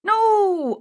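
very high, loud objection; protesting No. Acted. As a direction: cut them off sharply. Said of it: "argued a fourth man".

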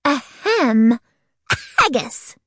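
word disguised as a fake cough; pretend cough. A narrator's reading. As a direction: clear your throat pointedly, then cough out the word. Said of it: "pretending to cough".

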